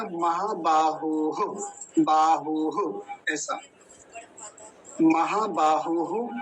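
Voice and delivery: महाबाहुः stretched, final ः breathed out; slow visarga demo. Shown as a demonstration. Teacher to students: model महाबाहुः slowly. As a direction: draw out महाबाहुः, close on h. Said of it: "तो क्या हो गया".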